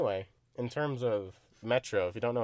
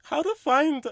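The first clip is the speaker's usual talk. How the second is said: high, slow mock headline read; sarcastic reading of a clickbait title; 13 semitones higher, 6 dB louder, slower than usual. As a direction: ham up the silly article title.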